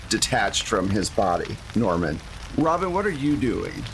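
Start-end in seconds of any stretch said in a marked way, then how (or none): none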